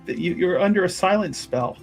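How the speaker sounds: normal talking voice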